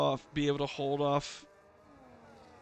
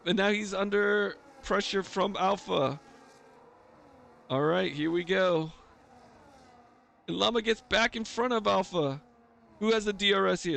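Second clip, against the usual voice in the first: excited, higher race call; motorsport commentator; 6 semitones higher, 3 dB louder than usual. Real, not acted. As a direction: call the close fight with rising energy.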